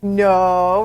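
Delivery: loud stern parent's no; mom's loud warning no; firm no. Retold or imitated. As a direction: stop the baby with one sharp word. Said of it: "That intonation".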